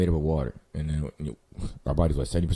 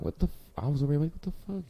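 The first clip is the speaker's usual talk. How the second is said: mock disbelief; 10 semitones higher, 7 dB quieter than usual. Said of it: "I'm like".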